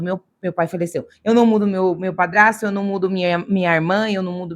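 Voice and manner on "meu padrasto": ordinary talk, nothing marked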